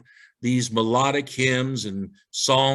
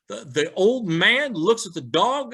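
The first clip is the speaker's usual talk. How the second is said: ordinary talk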